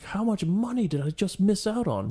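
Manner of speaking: high, frantic disbelief. Retold or imitated. Freaking out over race money.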